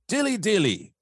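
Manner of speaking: high, put-on regal proclamation. Auditioning for the Dilly Dilly guy, high regal voice. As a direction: proclaim it in a lofty royal pitch. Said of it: "do it in a very regal voice".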